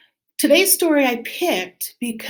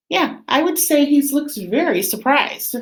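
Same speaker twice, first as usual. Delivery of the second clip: ordinary delivery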